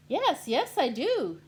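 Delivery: high loud eager yes; loud high excited reply. Acted. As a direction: burst out in agreement. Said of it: "Nebraska said excitedly".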